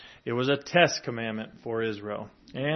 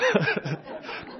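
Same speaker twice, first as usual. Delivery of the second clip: breathy laugh; 3 semitones higher than usual. Real, not acted. Laughs at own failed cleverness.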